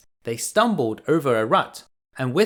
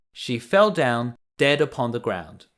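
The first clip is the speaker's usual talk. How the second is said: plain reading voice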